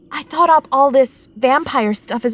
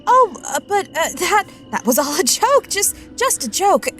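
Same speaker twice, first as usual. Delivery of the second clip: higher-pitched, flustered scramble to explain; nervous backtracking; 6 semitones higher than usual. Acted. Girl explains away her joke to Walter, nervous backtracking. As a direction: stammer and plead your way out of trouble.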